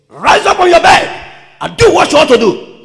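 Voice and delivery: high, very loud preacher's shout; thundering high-pitched command. Acted. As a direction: bellow it as a rousing order. Acts out a commanding voice.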